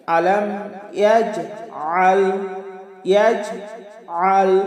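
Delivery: ইয়াজআল slow, the আ squeezed from the throat; ayn pressed from mid-throat. Shown as a demonstration. Teacher recites the second verse, ইয়াজআল with pressed ayn. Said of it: "একটু চাপ দিয়ে আমরা উচ্চারণ করব".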